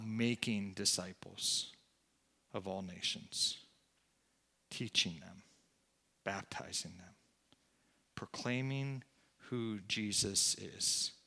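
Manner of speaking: low, soft sermon voice with long pauses; hushed preaching. Real, not acted. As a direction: bring it down to a reverent hush. Preacher drops to reverent hush.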